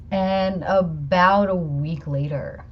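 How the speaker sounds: usual speaking voice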